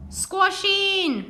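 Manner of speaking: a busy bus driver crying out; driver's cry. Acted. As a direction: call it out to the crammed bus. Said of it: "cried the driver".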